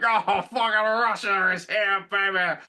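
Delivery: slow, high gloating drawl; mock gloat from the invading side; gloating Russian. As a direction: lord it over them, slowly. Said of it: "I'll be like".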